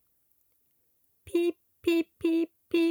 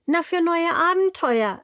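eager sheep; 9 semitones higher, 5 dB louder than usual. A narrator's reading. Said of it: "erklärt das Schaf".

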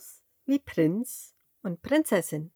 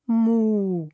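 a louder, drawn moo; cow moo; 5 dB louder than usual. A narrator's reading. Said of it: "und macht".

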